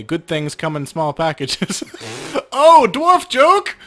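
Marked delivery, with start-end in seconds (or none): 2.6-3.8 high, loud, slow laughing shout; laughing exclamation. Real, not acted. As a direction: crack up at your own dwarf joke. Laughing at own joke.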